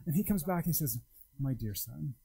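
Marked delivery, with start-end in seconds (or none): 1.4-2.1 tender father. Acted. God answers tenderly.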